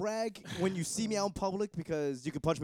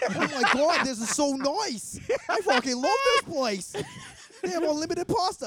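put-on much higher, louder gushing girl voice, laughing; gushing date; 12 semitones higher, 6 dB louder than usual. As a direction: ham up a date thrilled about pasta.

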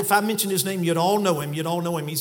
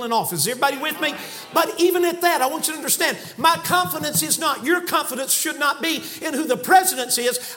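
preaching at full force; 6 semitones higher than usual. Acted. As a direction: preach it hard to the room.

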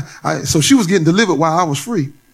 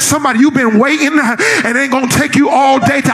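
full-force preaching; 8 semitones higher than usual. Acted. Preacher urging the congregation to praise God, in full-force preaching. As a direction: preach it out hard to the church.

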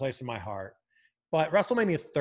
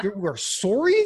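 Canadian "sorry"; 4 semitones higher, 4 dB louder than usual. Retold or imitated. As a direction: repeat it incredulously with a stretched Canadian O. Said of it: "when an American hears a Canadian accent".

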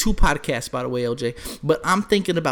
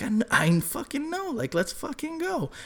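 hushed eager; 10 semitones higher, 5 dB quieter than usual. Retold or imitated. Friend eager to watch fight.

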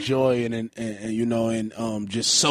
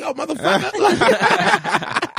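high, loud yelp through laughter; mock startled; 5 dB louder, much slower than usual. Host retells being spooked by the passing stranger, mock startled. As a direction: replay a jolt, hammed-up and laughing.

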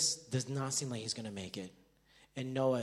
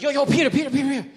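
loud, high, excited summons; urgent calling out; 12 semitones higher, 12 dB louder, faster than usual. Acted. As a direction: call him over repeatedly, all worked up. Ian urgently calling the speaker over.

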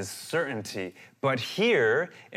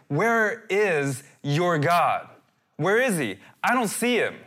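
loud, higher taunt, words drawn out at first; mocking challenge, raised in pitch; 6 semitones higher, 4 dB louder than usual. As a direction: jeer it, leaning hard on each word. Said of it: "his own tears are taunting him".

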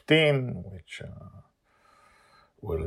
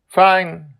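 curt reply; 13 semitones higher, 13 dB louder than usual. Acted. Joy answering curtly.

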